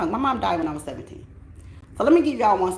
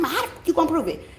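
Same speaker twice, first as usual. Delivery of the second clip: much higher, fast accusing mock mom; imitating angry mother; 11 semitones higher, faster than usual. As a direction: ham up mom yelling about her locks. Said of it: "She said".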